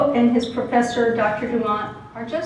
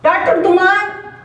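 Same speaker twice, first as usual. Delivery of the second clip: a hint of a timid student calling out, slow and a bit higher; student calling after his professor; student Beto; 8 semitones higher, 5 dB louder than usual. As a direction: call to the professor as a shy student. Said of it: "a student called Beto".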